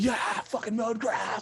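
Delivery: high, drawn-out mock cheer; slow, high-pitched hype yell; crowd going crazy. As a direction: whoop it like a wild studio audience. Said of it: "people start clapping and going crazy".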